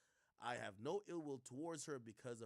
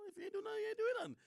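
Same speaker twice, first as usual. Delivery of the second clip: much higher, loud exasperated plea; loud pleading rant; 8 dB louder, much faster than usual. Real, not acted. Streamer shouting advice at Van, loud pleading rant. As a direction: yell at a friend to stop apologizing. Ranting at Van's statement.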